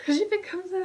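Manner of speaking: high laughing words; giggling through the words. Real, not acted. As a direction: laugh it out at your own slip. Laughs at her own slip.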